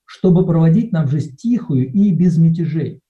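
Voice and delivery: ordinary delivery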